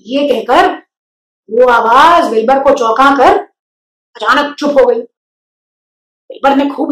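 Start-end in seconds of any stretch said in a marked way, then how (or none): none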